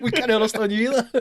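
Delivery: high, laughing Scouse voice; imitating the Liverpool lads, amused; mock Scouse reply. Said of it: "without missing a beat, were like".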